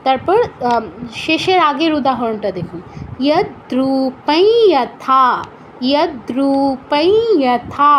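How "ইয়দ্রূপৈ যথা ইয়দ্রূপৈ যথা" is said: "ইয়দ্রূপৈ যথা" very slowly, a nasal ই closing "ইয়দ্রূপৈ"; slow nasal demo. Shown as a demonstration. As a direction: stretch each syllable of "ইয়দ্রূপৈ যথা", nasalizing the ই. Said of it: "চন্দ্রবিন্দুর মতো উচ্চারণটা করতে হবে". ("ইয়দ্রূপৈ যথা", খুব ধীরে, ই নাসিক্য করে)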